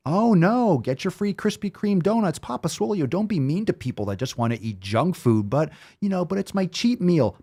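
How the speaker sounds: high, whining mock voice of a donut lover; mocking whiny critic. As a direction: sneer at them in a high whine. Mocking junk-food critics of him.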